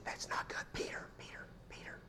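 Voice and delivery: whispered, barely there scolding; a limp whispered reprimand, played for laughs; mock gentle whisper. Preacher mocking a too-soft Jesus scolding Peter, whispered. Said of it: "Did Jesus go".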